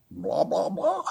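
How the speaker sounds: mock speechifying, leaping high at the end; mocking blather. Righteous person standing up to speak, mocking blather. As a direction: spout mock blah-blah, pitch jumping up.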